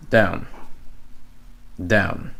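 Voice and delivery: down with a throaty French R. Shown as a demonstration. Teacher mimicking Israeli English R in down. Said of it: "It sounds like French".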